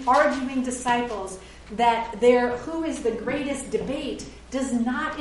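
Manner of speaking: ordinary delivery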